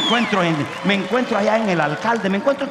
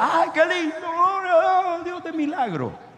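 mock sugary praise; 11 semitones higher than usual. Pastor mocking the comfortable believer's easy praise, high sugary voice. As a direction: lightly mimic a comfortable believer's easy gush. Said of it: "Entonces tú dices".

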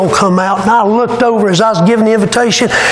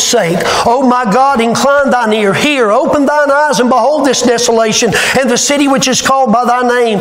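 scripture reading; 4 semitones higher than usual. Acted. Reads Daniel's prayer aloud.

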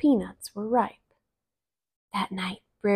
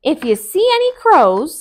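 Brer Rabbit; 8 semitones higher, 10 dB louder than usual. Acted. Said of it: "said Brer Rabbit".

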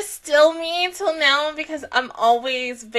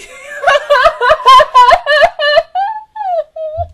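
high crying laugh; teary high laugh; 14 semitones higher, 8 dB louder than usual. Real, not acted. Fan overwhelmed at the screen, teary high laugh. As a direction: laugh through happy tears.

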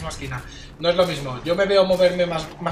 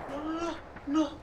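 very soft, high little "no"s; defeated whimper; 5 semitones higher, 12 dB quieter, slower than usual. Real, not acted. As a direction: whimper in disbelief. Match lost before the nuke.

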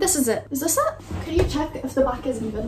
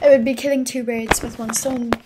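normal talking voice

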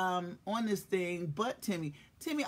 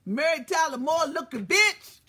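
loud, high mock insult; mock shout; 8 semitones higher, 9 dB louder than usual. Host yells a joke insult at the audience, loud and high. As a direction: belt out the insult, laughing.

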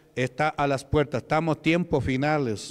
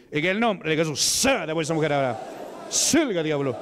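commanding exorcism. Real, not acted. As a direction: order the demon out. Commanding the demon out. (orden fuerte al demonio)

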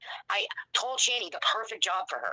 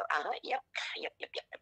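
placating retold agreement; 6 dB quieter than usual. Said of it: "I would just go".

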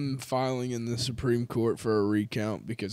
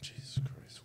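whispered; 15 dB quieter than usual. Real, not acted. Exasperated aside.

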